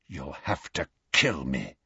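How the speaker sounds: hushed, low whisper in defiance; weak, low and barely voiced, through pain; Sirius. A narrator's reading. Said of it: "whispered Sirius".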